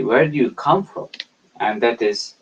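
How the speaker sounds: usual speaking voice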